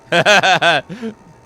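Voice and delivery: loud, high burst of real laughter. Real, not acted. Streamer laughing at the viewer count, loud burst. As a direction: crack up at the joke.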